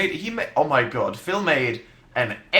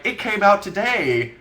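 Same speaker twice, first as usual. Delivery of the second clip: acted protest; 7 semitones higher, 6 dB louder than usual. Acts out their protest to YouTubers.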